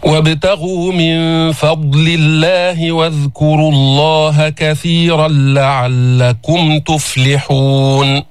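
slow chanted Quran recitation. Acted. Teacher recites the verse for the class, chanted slowly. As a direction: intone the verse slowly, tajwid style.

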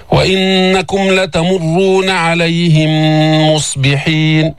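slow chanted verse; ترتيل بطيء; Quran recitation. Shown as a demonstration. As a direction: recite slowly, merging the mims. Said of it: "مش عليهم مصبحين".

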